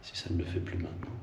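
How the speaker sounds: barely voiced murmur; whispering. Real, not acted. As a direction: breathe it out softly. Murmure en guettant un bruit. (murmure à voix basse)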